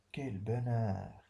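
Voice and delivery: drawn-out, delighted sigh of joy; joyful exclamation. Shown as a demonstration. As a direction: taste the happiness in each word. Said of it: "on exprime un sentiment".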